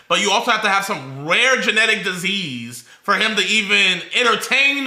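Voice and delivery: exasperated ranting; heated rant. Real, not acted.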